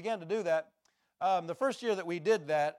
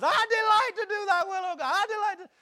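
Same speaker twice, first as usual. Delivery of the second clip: top of the voice; 9 dB louder than usual. Retold or imitated. Preacher repeating the verse at full voice. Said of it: "I said it three times".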